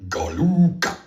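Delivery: Galuka with a long, drawn-out LU and a hard final KA; alien pronunciation. Shown as a demonstration. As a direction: attempt Galuka as a foreign alien name, middle stretched. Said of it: "they call it".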